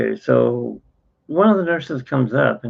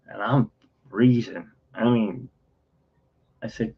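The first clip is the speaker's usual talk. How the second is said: ordinary talk, nothing marked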